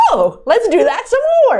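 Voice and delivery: high, swooping mock excitement; imitated eagerness. New private lenders keen for another deal, high mock eagerness. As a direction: pitch it up like a delighted newcomer. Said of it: "they were like".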